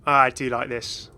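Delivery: usual speaking voice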